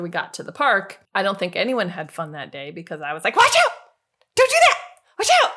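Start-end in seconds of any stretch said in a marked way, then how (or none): 3.4-5.5 shrieked warnings, high and loud; short, high-pitched alarmed shouts; panicked warning yell. Retold or imitated. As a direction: yell like a frantic babysitter. Acting panicked babysitter yelling warnings.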